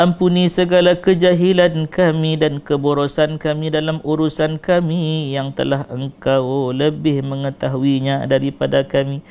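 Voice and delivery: ordinary talk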